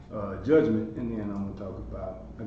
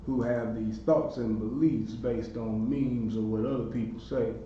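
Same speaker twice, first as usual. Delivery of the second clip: normal talking voice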